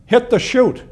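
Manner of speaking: high, loud shouted order; retold yell. Pilot to the gunner behind him, yelled command. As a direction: call it back sharply over engine noise. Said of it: "he yelled back to the gunner".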